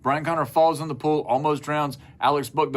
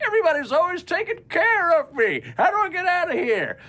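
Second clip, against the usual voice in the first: put-on high whiny kid voice; the pampered youngest waiting to be saved; helpless baby brother. Youngest child stuck in the pool, high whiny.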